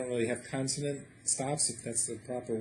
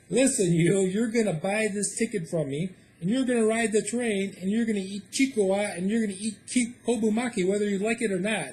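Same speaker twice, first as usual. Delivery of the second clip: higher, loud bossy horse voice; a horse-father scolding his son; 8 semitones higher, 4 dB louder than usual. Retold or imitated. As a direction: boss the child around like a scolding horse. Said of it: "the horse says".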